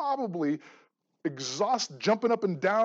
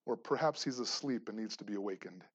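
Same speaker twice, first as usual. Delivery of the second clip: hushed, much softer, as if not to wake him; mock hush; 8 semitones lower, 9 dB quieter than usual. Elijah mocking the sleeping god, teasing hush. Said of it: "Elijah mocked them".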